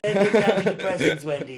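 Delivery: hammed-up cartoon boss, half laughing; Mister Burns. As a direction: parody a villain's command through laughter. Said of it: "Mister Burns".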